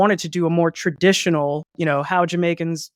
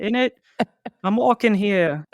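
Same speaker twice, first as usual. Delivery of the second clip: Christopher Walken; 3 semitones higher than usual. Shown as a demonstration. Host botches his Jamaican impression for co-host, as Christopher Walken. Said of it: "similarities from my Christopher Walken impression".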